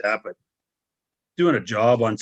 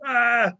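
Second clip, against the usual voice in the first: high pained cry, much higher; retold yell of pain; 12 semitones higher than usual. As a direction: yelp as if hurt in the ring. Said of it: "And I started yelling".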